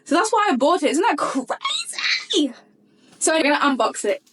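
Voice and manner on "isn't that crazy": high, drawn-out squeal of amazement; excited disbelief. Real, not acted. Telling viewers the gift matches what she wanted, an amazed squeal.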